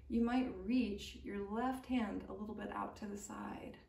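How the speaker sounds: gentle yoga teacher cueing the breath; guided meditation. Acted. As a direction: lull them through the neck rolls. Guided neck rolls with breathing.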